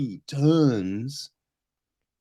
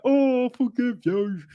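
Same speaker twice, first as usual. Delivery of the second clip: mock forgiveness; 7 semitones higher than usual. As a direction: ham up an easy forgiveness. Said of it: "it's just like".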